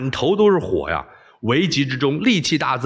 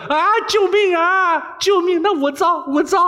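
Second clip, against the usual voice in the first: screaming and begging. Acted. Boy in pain screams at the princess to stop, high begging shriek. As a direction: howl for mercy, then give in. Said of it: "大叫".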